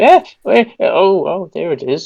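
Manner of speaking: put-on high, startled old washerwoman; flustered old lady. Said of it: "And it's like".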